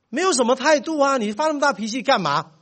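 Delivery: son complaining. Retold or imitated. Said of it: "干嘛啦？ 你是什么态度？".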